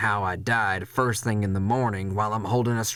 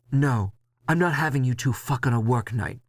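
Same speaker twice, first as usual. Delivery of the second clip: scolding boss; 4 semitones higher than usual. Acted.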